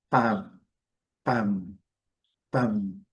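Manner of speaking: pam closed short on the m like a drumbeat; palm like a drum. Shown as a demonstration. Teacher showing palm without the L. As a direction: close the lips hard on palm. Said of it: "quase que um barulho de um tambor".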